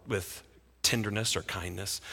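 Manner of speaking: ordinary talk, nothing marked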